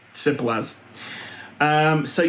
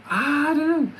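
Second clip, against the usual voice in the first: silly high voice; 12 semitones higher than usual. Acted. Repeats higher, sillier voice.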